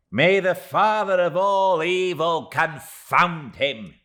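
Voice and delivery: high, loud and slow curse; damn him with all her tiny might; fierce little curse. Acted. Miss Moucher cursing Steerforth, high and loud. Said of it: "said the little woman".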